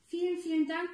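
an excited little fish bubbling over; exuberant thanks. A narrator's reading. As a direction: gush your thanks like a happy little fish. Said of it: "blubbert der kleine blaue Fisch übermütig".

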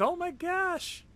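high, slow amazed "oh my gosh"; stunned by the damage; surprised. Real, not acted. Seeing big damage.

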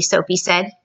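ordinary delivery

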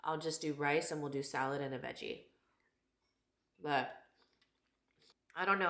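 ordinary talk

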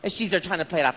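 ordinary talk

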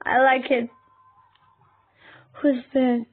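usual speaking voice

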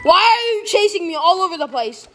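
high-pitched whining complaint, stretched at the end; exasperated protest. Real, not acted. Player yelling at a relentless pursuer, exasperated. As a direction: wail it at the one chasing you.

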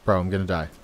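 ordinary talk, nothing marked

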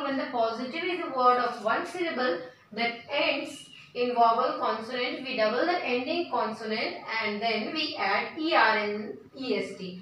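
reads the rule very slowly, word by word; slow dictation. Shown as a demonstration. Teacher dictating a grammar rule to students, very slow.

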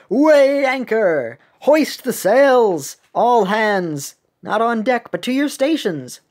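high, loud shipboard command; captain calling orders. Acted. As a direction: call out to the whole crew. Reads captain's orders in character.

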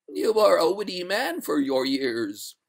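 high, slow, drawn-out spectral voice; eerie high ghost; water ghost. Acted. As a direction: intone it like a stately spectre. The ghost answers the master.

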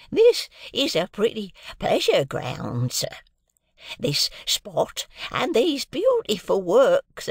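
put-on high, slow, quavering-free old man's voice, proud of the garden; a proud old father showing off the grounds, slowly. Old father speaks in story.

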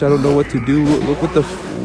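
ordinary delivery

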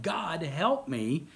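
slow, pleading cry to God; a man's slow plea for rescue; desperate prayer. Acted. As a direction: beg for help, drawn out and slow. Preacher voices a man praying.

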